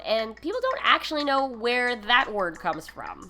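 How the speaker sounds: usual speaking voice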